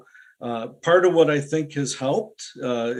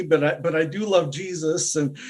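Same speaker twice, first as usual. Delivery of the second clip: normal talking voice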